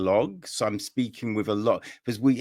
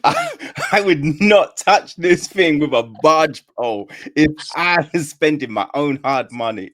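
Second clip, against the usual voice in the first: laughing; 4 semitones higher, 8 dB louder than usual. Real, not acted. Laughing complaint about boring book.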